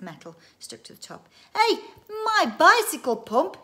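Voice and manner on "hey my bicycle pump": high, loud, drawn-out yell; boy's yell. A narrator's reading. Son spots his pump on the machine, yelling. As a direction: yell out in sudden recognition. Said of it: "yelled Andy".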